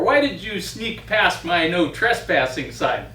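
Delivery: ordinary delivery, nothing marked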